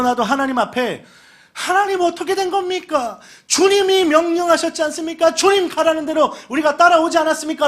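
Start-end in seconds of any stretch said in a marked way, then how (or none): 1.5-7.7 higher, louder protest to God; complaining Israelites. Retold or imitated. Israelites questioning God in the desert, pleading and protesting.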